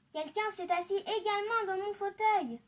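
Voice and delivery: lightly indignant middle bear exclamation; medium bear voice. A narrator's reading. Middle bear finding chair used, lightly voiced indignation. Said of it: "dit de sa voix moyenne".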